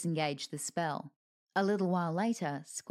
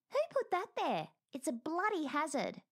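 indignant grumble; 10 semitones higher than usual. Acted. Chedwick complains after bumping into it.

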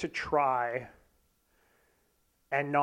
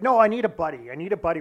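high, loud, urgent appeal; retold insistent pleading; insistent plea; 3 semitones higher, 7 dB louder, faster than usual. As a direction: press a friend urgently to join in. Urging his reluctant friend to join.

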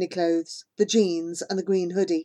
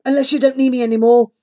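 hurt challenge; 4 semitones higher, 9 dB louder, faster than usual. A narrator's reading. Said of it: "He gives me a hard stare".

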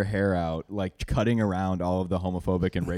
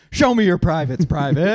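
loud, high drill sergeant call; drill sergeant bark; 8 semitones higher, 7 dB louder than usual. Retold or imitated. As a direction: bark it at the privates. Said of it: "their drill sergeant goes".

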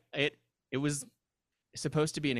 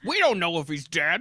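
loud, high, brash studio exec; Hollywood producer voice; 6 semitones higher, 8 dB louder than usual. Acted. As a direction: bark it like a pushy movie producer. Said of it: "Apparently that's my Hollywood producer voice".

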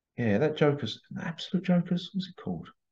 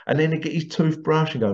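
usual speaking voice

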